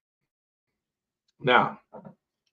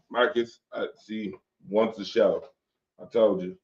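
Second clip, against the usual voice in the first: ordinary delivery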